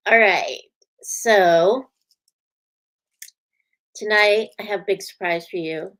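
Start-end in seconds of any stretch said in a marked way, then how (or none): none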